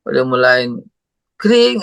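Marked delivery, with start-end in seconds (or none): 1.4-1.8 phone ring. Retold or imitated.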